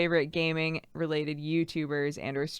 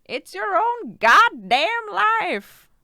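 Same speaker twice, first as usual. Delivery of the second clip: put-on higher, slow old granny with an accent; hammed-up granny telling you to live your life; 12 semitones higher, 5 dB louder, slower than usual. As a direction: play a feisty old grandma. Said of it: "Do a granny voice".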